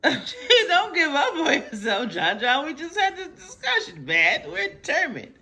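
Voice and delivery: high, loud laughing encouragement; giggling. Real, not acted. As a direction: cheer him on through laughter. Mom laughing, encouraging son.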